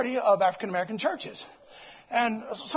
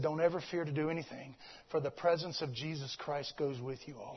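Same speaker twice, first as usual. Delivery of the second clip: much lower and softer, hushed; the voice of God; 11 semitones lower, 13 dB quieter than usual. Retold or imitated. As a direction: speak it as a hushed holy voice. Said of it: "a voice spoke and said".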